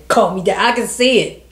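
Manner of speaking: ordinary delivery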